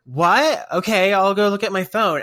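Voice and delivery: loud, high puzzled reply; calling back. Retold or imitated. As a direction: shout back in confusion. Retold shouted exchange across store.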